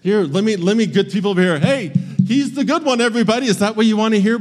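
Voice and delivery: a snide father loudly announcing his good son; sarcastic announcement. Pretend. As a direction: overblown sarcastic shout for all to hear. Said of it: "I would be so passive aggressive".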